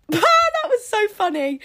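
high, loud giggly squeal; squealing with laughter; laughing. Real, not acted. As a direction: crack up at the mishap. Laughing at speaker mishap.

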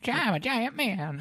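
very high, slow squeaky giant; drawn-out squeaky cartoon voice; silly giant voice. Joking giant man voice.

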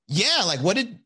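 loud, high imitation of a scornful date; mock their naivety with rising outrage; hammed-up incredulous scorn; mock indignant. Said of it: "she showed me her crazy side".

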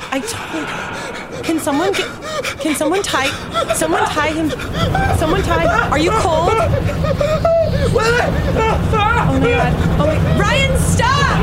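high, loud panic building into a shrieking yell; panicked screaming. Acted. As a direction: scream at Ryan to stop, climbing into shrill panic. Panics as Ryan attacks someone.

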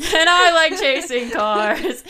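loud, high, slow sketch voice with laughter; comic misfit character. Acted. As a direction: belt it out slowly as the weirdo. Repeats line in sketch character.

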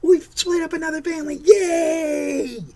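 high, loud, shouted mock cheer; hammed-up jeering celebration; mock gloating politician. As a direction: gloat like a cartoon villain celebrating. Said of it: "and they're going".

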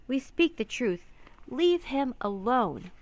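high, loud demand, slowing at the end; impassioned shout. A narrator's reading. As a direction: plead loudly to a crowd, stretching the last words. Child shouting over sailors.

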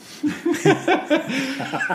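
high-pitched burst of laughing; real laughter. Real, not acted. Co-host reacting to a Shaggy joke, high giggling laugh. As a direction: crack up at the joke.

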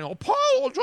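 put-on a high squealed Boston accent; mock Boston accent. Host imitating the screaming Boston woman in her accent. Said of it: "I can't even do the apologize Boston accent".

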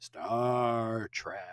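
low, soft, hushed title reveal. Acted. Podcaster unveiling the topic to his co-host, hushed. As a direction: drop low and quiet for the big reveal.

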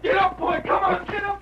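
very high, desperate shout to the horse; frantic rider shouting his fallen horse to its feet; urgent shout at horse. Acted. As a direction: scream at the horse to get up. Urging his horse to rise.

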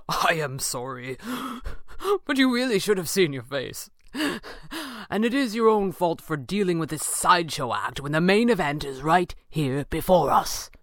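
speaking between acted chuckles; amused, laughing villain voice; convulsed with laughter. A narrator's reading. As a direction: apologise while still laughing at him. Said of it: "still convulsing".

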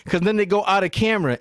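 normal talking voice

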